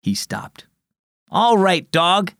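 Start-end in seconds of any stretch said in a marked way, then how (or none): 1.3-2.3 raised, firm voice to a dog; said loudly. A narrator's reading. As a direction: call it out, standing his ground. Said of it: "he said loudly".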